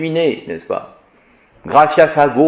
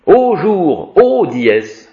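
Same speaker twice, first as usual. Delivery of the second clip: lifted, solemn "ô jour" called out higher; declaimed address; 6 semitones higher, 3 dB louder, much slower than usual. Shown as a demonstration. Teacher showing the vocative with a high, solemn call. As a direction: hail the day like a poet.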